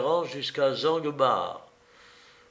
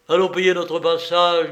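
loud, higher, decided delivery; violently resolute; 4 semitones higher, 8 dB louder than usual. A narrator's reading. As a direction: announce it with forceful determination. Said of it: "d'un ton violemment résolu".

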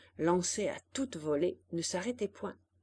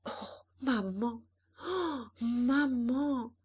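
slow, breaking, awed murmur; broken voice; 4 semitones higher, slower than usual. A narrator's reading. Jeanne watching her mother soar, slow broken voice. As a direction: let the voice break on each maman. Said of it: "d'une voix brisée".

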